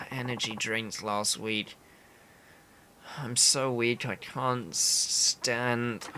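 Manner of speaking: drained, stammering, running out of strength; starving weak man. Acted. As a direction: play someone too weak from hunger to move.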